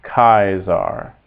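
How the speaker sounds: "Kaiser" drawn out slowly as KAI-sar; slow Latin "Kaiser" with a K and "eye" vowel; correct hard C. Shown as a demonstration. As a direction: give the right version, KAI-sar, slowly. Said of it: "but Kaiser".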